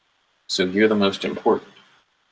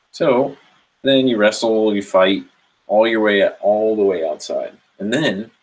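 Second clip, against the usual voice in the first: usual speaking voice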